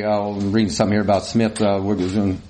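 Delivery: low, hurried mumble; absent-minded mumble behind the paper; mumbled. Retold or imitated. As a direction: rattle it off low and indistinctly. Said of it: "I just kinda mumbled".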